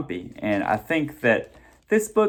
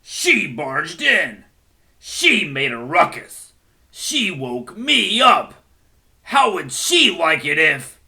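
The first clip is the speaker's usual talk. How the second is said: indignant; 3 semitones higher, 6 dB louder than usual. Acted. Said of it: "Bear was indignant".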